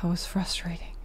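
tired breathy whisper. Real, not acted. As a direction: whisper it, drained. Exhausted after chase.

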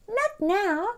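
high, light kitty voice; lightly voiced kitty turning them down; cat reply. A narrator's reading. Sushi voiced.

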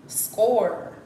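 "Score" said loud, with a clear final R; standard pronunciation. Retold or imitated. Mimicking how other people say it, "Score" with a full R. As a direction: pronounce every sound of "Score". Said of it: "people really be like".

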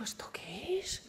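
hushed, breathless whisper, slow; awed whisper. Retold or imitated. Woman in church reacting to a voice, awed whisper. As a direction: whisper it as if the altar just spoke.

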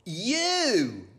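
high, drawn-out blame; high possum voice; accusing possum. Acted. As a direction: stretch the word out accusingly.

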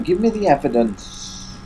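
ordinary talk, nothing marked

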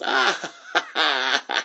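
a high mocking "Ah!" then a breathy laugh; scornful cackle trailing off. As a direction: laugh right in his face. Lucy laughs at Charlie Brown.

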